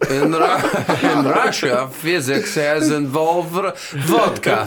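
put-on Russian accent. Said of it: "This, this Russian professor".

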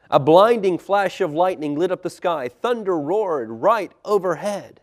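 loud, high storm narration building, then dropping away; dramatic story narration. A narrator's reading. Storyteller describing the storm hitting the boat, dramatic narration. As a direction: drive the storm along, then hush at the end.

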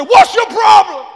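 loud, high yell, dragged out; furious retold shout. Preacher re-enacts confronting a driver, furious shout. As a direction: blast it at the other driver at full volume. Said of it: "I did just like that".

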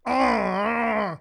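long strained "uhh" groan; stiff strained groan. Retold or imitated. Imitating his friend frozen stiff after the crash, strained groan. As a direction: groan as if locked rigid. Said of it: "like that. My man's is like".

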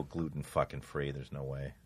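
very low, whispered aside; hushed refusal. Real, not acted. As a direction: let it drop to a near-silent whisper. Muttered refusal to go gluten-free.

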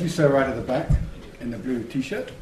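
ordinary talk, nothing marked

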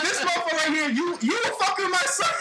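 high-pitched imitation, laughing through words; mock a puny opponent while cracking up; laughing disbelief. Dad laughing at the man who touched his son, amused scorn. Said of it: "first thing he did was just start laughing".

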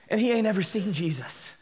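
ordinary delivery

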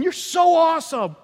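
high, loud, starstruck woman's gush. Retold or imitated. A woman in the crowd praising Jesus, high gushing. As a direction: gush like an awestruck fan. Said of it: "she's just watching this saying".

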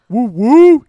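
woo cheer. Pretend. Hyping the song.

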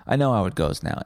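normal talking voice

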